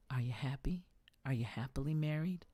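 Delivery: imitated secretive whisper, lifting into a question; furtive whisper. Admirer secretly asking a married woman, furtive whisper. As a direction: whisper it so nobody else overhears.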